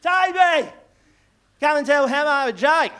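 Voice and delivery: loud high call across the room. Retold or imitated. Parent calling the boy over to the comedian, loud call. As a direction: shout the kid's name over the party.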